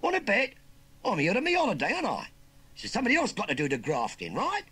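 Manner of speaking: high, loud Cockney bullying; cocky challenge. Acted. Nephew refusing to work on holiday, cocky challenge. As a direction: argue back loud and brash. Nephew bullies uncle into working.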